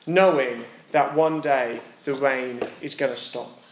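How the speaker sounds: ordinary talk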